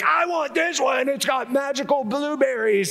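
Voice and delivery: put-on high, whiny child begging for cereal; a child whining for sugary cereal; whiny kid. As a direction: squeal like a kid in the cereal aisle. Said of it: "be like".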